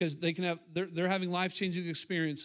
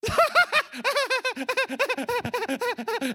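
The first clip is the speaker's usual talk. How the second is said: hammed-up high cackle, much higher and louder; mock giddy laugh; 7 dB louder than usual. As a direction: act out the exhausted person laughing at nothing. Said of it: "Or else they do this".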